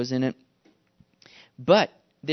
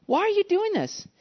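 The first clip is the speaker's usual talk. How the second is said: very high-pitched puzzled question; bewildered soldier; 13 semitones higher than usual. Acted. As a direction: plead for a reason, voice pitched up. Soldier baffled by the help.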